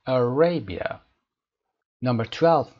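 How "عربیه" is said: عربیه with RA loud and firm; stress on RA. Shown as a demonstration. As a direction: punch the RA in عربیه. (تأکید روی ر در عربیه)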